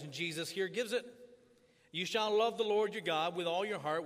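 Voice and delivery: ordinary talk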